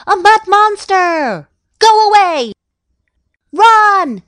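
high, loud cries of alarm; panicked shout. A narrator's reading. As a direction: scream a warning to flee. Panicked flight from the mud monster.

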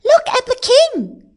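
high, loud cry of alarm; crowd's shout. A narrator's reading. People see king stuck at top, shouting. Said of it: "shouted the people".

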